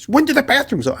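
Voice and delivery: high-pitched mock demand; mock exasperation, voice pitched well up; angry woman imitation. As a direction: imitate a pestering, irritated stranger. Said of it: "like an angry chicken".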